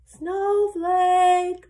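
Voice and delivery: long drawn-out, high, loud call; searching call. A narrator's reading. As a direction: call across the hills for a lost lamb. Shepherd calling for lost lamb.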